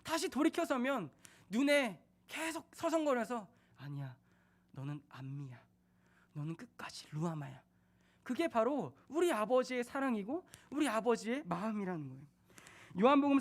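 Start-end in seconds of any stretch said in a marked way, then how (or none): 3.8-7.6 whisper. Acted. God tender, whispering love.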